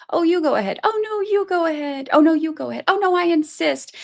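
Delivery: high, sugary fake-polite insisting; mock politeness. Mocking rivals holding a door, in fake politeness. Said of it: "they're like".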